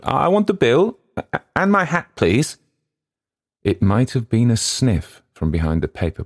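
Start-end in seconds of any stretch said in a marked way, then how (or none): 0.0-2.6 high and louder, insisting on the bill. A narrator's reading. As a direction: raise the voice to demand the bill. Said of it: "on a rather louder note".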